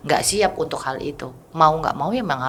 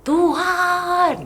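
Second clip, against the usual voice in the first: anguished cry. Retold or imitated. Husband crying out to God after the diagnosis, high anguished shout. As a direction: cry out to heaven in despair. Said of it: "pulang dia teriak".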